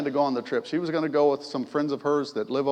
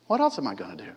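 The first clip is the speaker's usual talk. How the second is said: usual speaking voice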